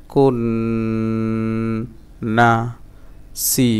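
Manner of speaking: each syllable from বিল to সি set apart by pauses; broken-up syllables. Shown as a demonstration. Teacher drilling learners on a long verse, syllable by syllable. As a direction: hold খুন, ওয়া and কুন long, stop after each. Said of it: "আবার ভাঙা ভাঙা উচ্চারণ".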